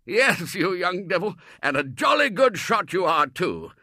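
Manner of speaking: loud, high, jovial scolding; hearty Colonel. Acted. Colonel teasing Robert about his aim, loud and jovial. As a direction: rib the boy fondly.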